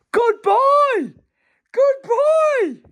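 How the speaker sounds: high, loud praise; excited praise. Real, not acted. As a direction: cheer like he's a hero. Praising baby after walking.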